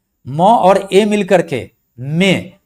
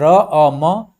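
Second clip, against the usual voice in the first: usual speaking voice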